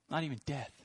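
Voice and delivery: ordinary talk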